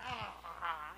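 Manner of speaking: ordinary talk, nothing marked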